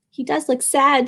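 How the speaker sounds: ordinary delivery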